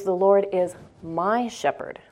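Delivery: "my" held long and set apart; stress on MY. Shown as a demonstration. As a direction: lean on "my" so it sinks in. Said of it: "if you repeat that several times".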